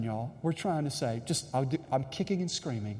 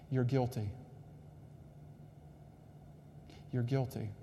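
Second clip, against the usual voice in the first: solemn and soft; 3 semitones lower, 11 dB quieter than usual. Acted. Solemn verdict to the congregation.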